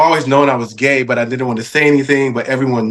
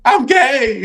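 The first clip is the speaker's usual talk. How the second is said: big reveal; 11 semitones higher than usual. Retold or imitated. Acting his announcement.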